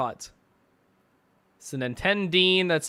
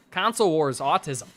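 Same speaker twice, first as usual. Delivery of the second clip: ordinary talk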